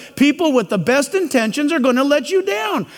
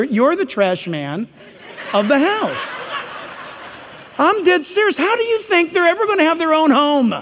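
normal talking voice

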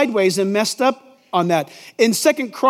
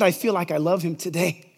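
much softer, dreamy bride voice; bride's vow; 7 semitones lower, 6 dB quieter than usual. Retold or imitated. Bride at the altar telling the groom, soft and dreamy. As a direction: breathe it to the groom like a fickle bride.

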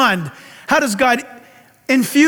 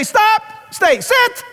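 God's command; 10 semitones higher, much slower than usual. Retold or imitated. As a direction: command like God would. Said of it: "He might say".

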